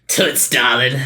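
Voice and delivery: lightly voiced smooth-talking cat; suave Tom voice. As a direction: croon it like a sleazy charmer. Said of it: "do the voices".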